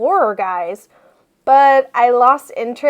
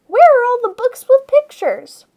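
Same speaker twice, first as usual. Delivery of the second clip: higher, louder complaint; exasperated kid wailing about books; whiny outburst; 11 semitones higher, 6 dB louder than usual. Acted. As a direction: demand it like a fed-up kid.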